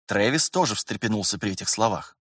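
plain reading voice

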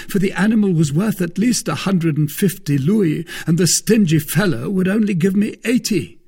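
high-pitched, emphatic grumble; aggrieved Porthos. A narrator's reading. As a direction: complain grandly, pitched high. Voices Porthos complaining of the price.

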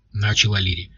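ordinary delivery, nothing marked

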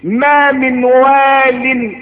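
ordinary talk

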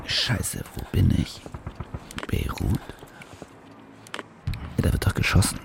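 hushed, breathy muttering to himself; tense whispering. Acted. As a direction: whisper it, scared of being heard. Waking alone in a strange city.

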